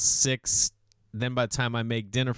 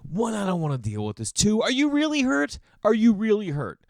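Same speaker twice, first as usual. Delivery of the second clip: much higher, louder, fed-up parent; exasperated inner voice; 10 semitones higher, 4 dB louder than usual. Retold or imitated. Annoyed dad in his head at his crying daughter, exasperated. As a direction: fling the question up in disbelief. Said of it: "part of me is just like".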